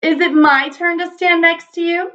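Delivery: a hint of a tiny child asking hopefully; the little Zero asking Zero for a turn, in a small voice. A narrator's reading. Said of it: "the new little Zero asked".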